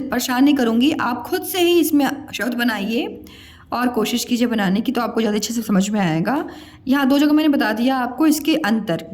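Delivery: usual speaking voice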